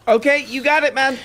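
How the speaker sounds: high sarcastic okay; mock high dismissive. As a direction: brush him off, sarcastic and high. Mocking to video guest.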